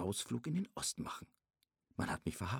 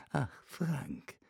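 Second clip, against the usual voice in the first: spottend nagedaan; 5 dB quieter than usual. Retold or imitated. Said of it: "äffte er sie nach".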